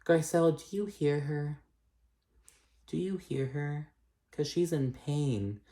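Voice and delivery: soft, high peacemaker voice; imitating a peacemaker. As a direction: plead gently for understanding, hushed and high. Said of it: "trying to play peacemaker".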